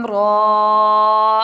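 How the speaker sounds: را stretched loud for the full madd; tajweed recitation; long drawn recitation. Shown as a demonstration. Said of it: "کھینچیں گے تین الف کی مقدار".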